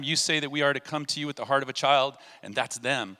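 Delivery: normal talking voice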